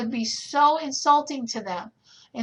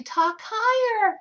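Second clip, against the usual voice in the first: higher; 10 semitones higher, 4 dB louder, slower than usual. Shown as a demonstration. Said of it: "What's your tone of voice?".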